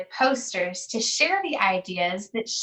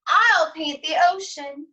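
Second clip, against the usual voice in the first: put-on a bit higher, bright kid voice; a third classmate offering to help, in a higher kid voice; higher child voice; 6 semitones higher than usual. A narrator's reading. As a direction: lift the pitch for another child. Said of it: "said a third".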